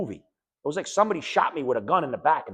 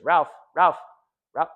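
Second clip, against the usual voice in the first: loud, higher repeated calls; calling his name; 4 semitones higher, 7 dB louder, slower than usual. Retold or imitated. Sister calling Ralph over the phone, loud and alarmed. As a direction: call out to someone who stopped answering.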